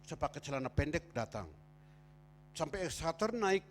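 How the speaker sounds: ordinary talk, nothing marked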